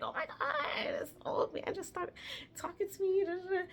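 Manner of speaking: high, breathy excited telling; excited gush. Retold or imitated. Telling friends about the old man, high excited gush. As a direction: gasp it out to friends. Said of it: "I was just like".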